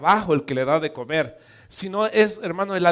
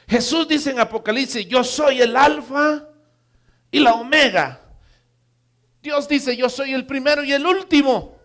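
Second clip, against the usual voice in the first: high, loud proclamation of scripture; emphatic preaching; 7 semitones higher, 6 dB louder than usual. Acted. Preacher declares Jesus the Alpha and Omega, emphatic preaching. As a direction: proclaim it forcefully to the congregation.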